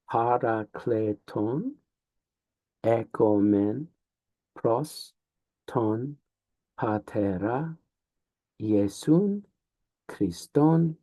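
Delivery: slow, syllable by syllable; over enunciating. Shown as a demonstration. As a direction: take it one syllable at a time. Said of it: "trying to demonstrate how".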